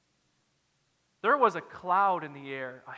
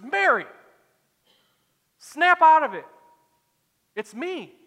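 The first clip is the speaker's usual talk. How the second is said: high, loud, urgent shout; Jesus yelling; 8 semitones higher, 11 dB louder than usual. Acted. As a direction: shout to jolt someone out of grief. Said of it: "And then Jesus yells".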